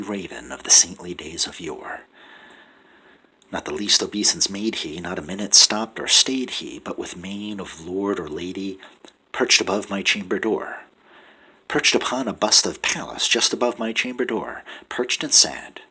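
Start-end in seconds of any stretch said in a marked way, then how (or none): none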